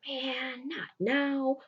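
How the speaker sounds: soft play-acted exasperation; frustrated aside. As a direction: act out dismay at an interruption.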